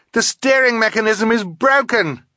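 put-on high, loud girl's yell; urgent high-pitched shout; alarmed yell. A narrator's reading. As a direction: yell the emergency to the others. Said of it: "she yelled".

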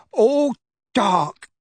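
high, strained, shuddering voice; hoarse, shuddering. A narrator's reading. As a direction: force it out hoarsely through a shudder. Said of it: "Dudley said hoarsely".

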